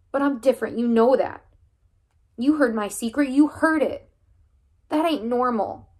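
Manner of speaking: high, loud, strained and pleading; boy straining to explain his secret to the coach. Acted. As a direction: push through a voice about to break. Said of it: "my voice now straining".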